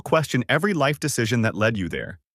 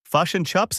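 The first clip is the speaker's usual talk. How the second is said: mock New Zealand accent, pitched up; Kiwi accent; 7 semitones higher, slower than usual. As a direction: tease the New Zealand vowels. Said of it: "Well, a version of it".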